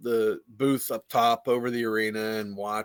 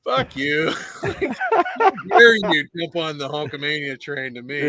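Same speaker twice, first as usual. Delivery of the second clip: higher, laughing mock indignation; mock outrage; 4 semitones higher, 5 dB louder than usual. Mock outrage at Quinn's Hulkamania pitch. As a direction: fake offended, laughing.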